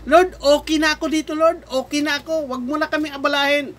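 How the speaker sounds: high-pitched mock plea; complacent Israelites. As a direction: beg off like someone too comfortable to move. Acts out complacent Israelites to God.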